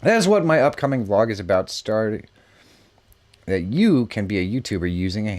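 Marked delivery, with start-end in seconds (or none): none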